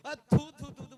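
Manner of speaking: loud mock spitting; spitting out tea. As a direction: spit the tea out hard. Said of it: "मैंने कहा".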